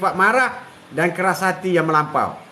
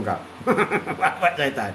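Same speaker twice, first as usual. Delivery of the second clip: laughing; 5 semitones lower, 4 dB quieter than usual. Real, not acted. Joke about the devil's father.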